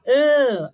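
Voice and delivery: "an" sustentado, com o A pelo nariz; som nasal. Shown as a demonstration. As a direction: prolongue "an" como vogal nasal. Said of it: "que a gente fala nasal". ("an" nasalizado e alongado)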